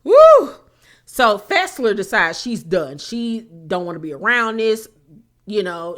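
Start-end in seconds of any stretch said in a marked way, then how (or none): 0.0-0.5 high loud whoop; excited whoop. Real, not acted. Reality show recapper whooping at the drama. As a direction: let out a gleeful woo.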